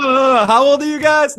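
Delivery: high, loud imitation of startled listeners; exaggerated, incredulous outburst; mock shock. Mimicking listeners' reaction to their references.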